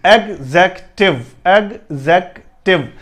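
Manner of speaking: "एग्जेक्टिव" slowly, eg-ZEK-tiv, no yoo sound; slow model of "एग्जेक्टिव" in three beats; correct executive. Shown as a demonstration. Teacher slowly models "एग्जेक्टिव" without the yoo. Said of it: "ये एग्जेक्टिव है".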